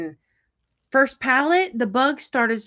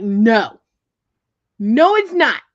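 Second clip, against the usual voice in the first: loud retort; 8 dB louder, slower than usual. Retold or imitated. Telling boyfriend off at the pond, loud retort. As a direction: shoot him down loudly. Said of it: "I'm like".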